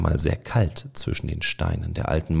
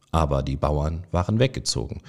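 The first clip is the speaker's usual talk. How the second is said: ordinary delivery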